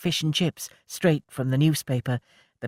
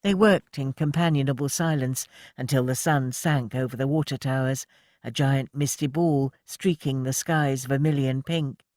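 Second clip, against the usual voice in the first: ordinary delivery, nothing marked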